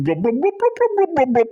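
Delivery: bubbling sounds leaping in pitch; mock drowning blubs. Prankster pretending to drown in a pond, burbling. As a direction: burble it like someone going under. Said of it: "pretended to drown, going".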